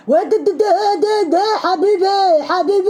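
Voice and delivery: high-pitched stammering imitation; hammed-up flustered stammer, pitched high; mock stutter. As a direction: stutter on the first syllable, then stretch the word out. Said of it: "وقعد يتأتأ ومبرق عينه".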